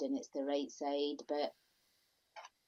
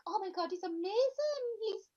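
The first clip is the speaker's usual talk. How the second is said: high-pitched gushing fan; over-the-top starstruck praise; gushing mock admiration; 11 semitones higher than usual. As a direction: imitate awestruck listeners, pitched way up. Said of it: "Everybody will listen to you and be like".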